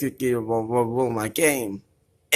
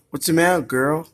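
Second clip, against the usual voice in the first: normal talking voice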